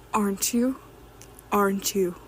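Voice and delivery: ordinary talk, nothing marked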